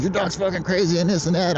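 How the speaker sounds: a neighbour yelling about a dog attack, mock yell; imitating a yell. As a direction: replay the dude's yell, half in jest. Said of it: "The dude started yelling at me".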